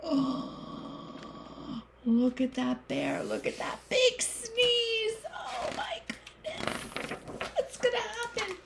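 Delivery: high breathy gasping wonder; amazed. Real, not acted. Teacher marvels at the picture for children, high amazed.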